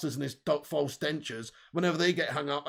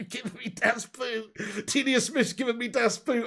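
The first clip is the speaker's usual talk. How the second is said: put-on German accent catchphrase; 5 semitones higher than usual. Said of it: "repeating the Farke line".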